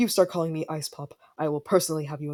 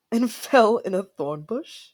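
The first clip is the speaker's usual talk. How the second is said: laughing; 5 semitones higher, 4 dB louder, slower than usual. Acted. Laughing at childhood story.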